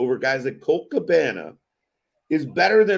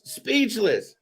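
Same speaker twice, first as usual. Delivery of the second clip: speechless; 7 semitones higher than usual. Real, not acted.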